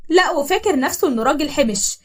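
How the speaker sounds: plain reading voice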